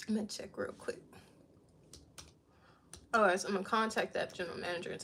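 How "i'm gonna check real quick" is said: whispered aside to herself. Real, not acted. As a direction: murmur it softly while checking. Aside while checking phone.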